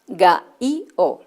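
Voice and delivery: ga-i-o with the t sounds removed, split apart; word without t. Shown as a demonstration. Teacher showing gatito without its t sounds. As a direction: say ga-i-o in separate bits. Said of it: "sin el sonido t".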